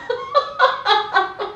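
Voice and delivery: high, rippling chuckle; amused laugh. Acted. Wife recalling husband at the Palazzo Venezia, amused laugh. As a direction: laugh at a fond memory.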